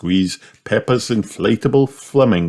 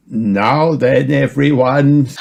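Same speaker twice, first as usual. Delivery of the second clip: higher-pitched granny announcing; raised, lilting granny voice; kindly granny figure; 6 semitones higher, 4 dB louder, slower than usual. A narrator's reading. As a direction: call the group to attention. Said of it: "said Granny Pig".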